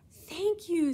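high, loud joyful thanks; overjoyed. Acted. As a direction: gush with delight. Overjoyed at the gift.

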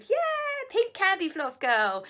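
high-pitched poppy California girl imitation. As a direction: squeal a mock bubbly party-girl yeah, then draw it out. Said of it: "that real California, [name], poppy sort of".

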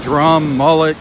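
ordinary talk

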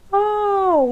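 very high, loud howl; yowl of pain. A narrator's reading. Stung badger cries out in pain, loud yowl. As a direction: yelp as the nose gets stung. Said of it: "începu să urle acesta".

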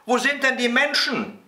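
put-on high, childish call. A narrator's reading. Little boy to rooster on the tower, high call. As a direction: call up to someone in a squeaky boy's voice. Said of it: "rief der kleine Häwelmann hinauf".

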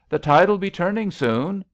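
loud, high-pitched warning; calling out. A narrator's reading. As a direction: call it out to a distant swimmer. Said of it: "called a life guard".

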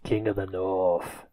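put-on low, slow Westerosi accent; soft, deep mock Westerosi accent. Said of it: "that was a northern Westerosi accent".

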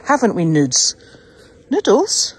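Noodles called high and loud; calling the dog. Real, not acted. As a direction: call the puppy's name up high.

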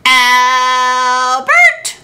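a loud call of Albert!; wife shouting for her husband; wife yells. Acted. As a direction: yell Albert! up the stairs. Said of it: "the farmer's wife yelled".

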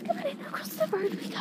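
high sing-song call to dog. Real, not acted. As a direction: lead the dog across, high. Calling the dog across road.

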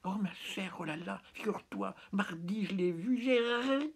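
very high, put-on girlish chatter. A narrator's reading. Poet imitates the girl gossiping to her friend, high-pitched girlish chatter. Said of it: "Elle cause tout bas ... elle dit comme ça".

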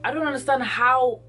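ordinary talk, nothing marked

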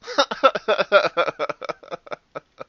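long, high staccato laugh dying away; loud laugh. Real, not acted. Player laughing after a risk paid off, long staccato cackle. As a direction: burst out laughing, then run out of air.

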